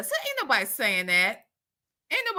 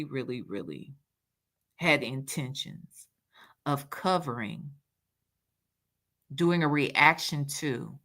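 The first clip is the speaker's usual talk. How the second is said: weary low voice; 7 semitones lower, 5 dB quieter than usual. Real, not acted.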